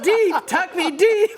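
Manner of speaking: high, loud and laughing; laughing through it. Retold or imitated. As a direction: crack up while saying it. Reliving home run, laughing.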